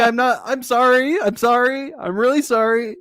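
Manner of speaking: high-pitched mock-nervous apologizing; nervous wreck. Sophia apologizing to Nick, high mock nerves. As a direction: imitate a flustered string of apologies. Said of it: "She is a nervous wreck".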